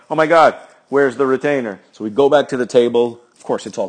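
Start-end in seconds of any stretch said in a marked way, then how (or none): 0.1-1.8 panicked. Retold or imitated. Retold panic over lost retainer.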